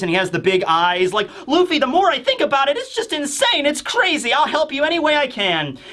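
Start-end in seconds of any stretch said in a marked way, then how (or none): 1.5-5.8 high, fast tearful mock voice; hammed-up overwhelmed squeal; Chopper. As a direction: gush in a high voice as if through tears. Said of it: "tears are now strolling down his face".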